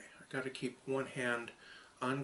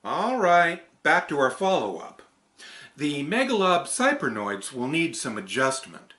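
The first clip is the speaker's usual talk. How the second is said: raised, louder character voice; loud, higher puppet-character delivery; character read; 5 semitones higher, 13 dB louder than usual. Acted. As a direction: perform the script line in a bright character voice. Retake of the character script.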